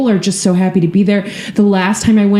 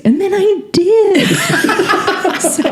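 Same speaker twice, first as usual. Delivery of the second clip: laughing; 13 semitones higher, slower than usual. Real, not acted. Laughs at own joke.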